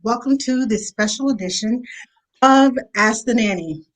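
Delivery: usual speaking voice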